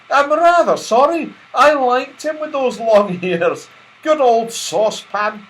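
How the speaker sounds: wistful but amused; Silky voice. A narrator's reading. Silky noticing Saucepan's lost ears, fond regret.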